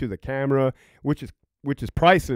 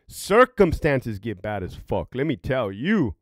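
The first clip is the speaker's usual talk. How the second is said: usual speaking voice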